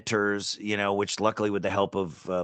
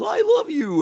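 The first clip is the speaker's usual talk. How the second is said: high stuffed rabbit voice; sweet little toy squeak, pitched way up; talking toy voice; 5 dB louder than usual. Retold or imitated. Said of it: "Ant-Man's got this ugly stuffed rabbit and he goes".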